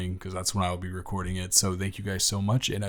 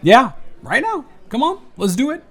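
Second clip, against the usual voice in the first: playful urging; 12 dB louder than usual. Acted. Urging date to stand up.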